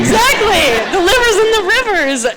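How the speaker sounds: high, loud exclamation; excited agreement. Real, not acted. Speaker seizing on an audience member's joke, excited. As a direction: burst out in delighted, high-pitched agreement.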